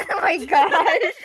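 high, breathless fit of laughter; can't stop laughing, squeaking; laughing hard. Real, not acted.

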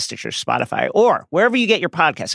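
ordinary delivery

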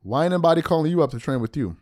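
ordinary delivery

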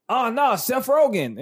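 high-pitched mock dismay; mock dismayed viewer. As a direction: imitate someone reacting to a cast list. Voices a viewer reacting to cast list.

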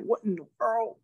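high incredulous question; can't believe the crowd; amazed. Retold or imitated. Retold own amazement again.

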